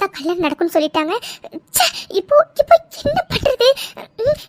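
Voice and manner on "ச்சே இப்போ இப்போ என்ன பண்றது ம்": high-pitched, worried exasperation; exasperated fretting. Acted. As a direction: fret aloud, pitched well up. Fretting alone over the wedding.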